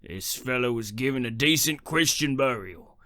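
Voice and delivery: rising, loud outrage; angry accusation. Acted. Caretaker scolding the grave robber, building to a loud angry yell.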